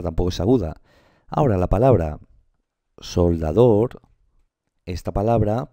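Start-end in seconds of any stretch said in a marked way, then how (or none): 3.0-4.0 soldador very slow, syllable by syllable; sounded out. Shown as a demonstration. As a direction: stretch out every syllable of soldador. Teacher sounds out the word.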